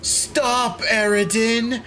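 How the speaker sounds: high, whiny please stop; high pleading. Real, not acted. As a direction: beg him to stop, high and playful.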